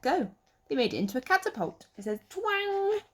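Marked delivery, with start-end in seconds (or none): 2.3-3.0 high springy twang; twang noise. Acted. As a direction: make the catapult go twang. Catapult sound word.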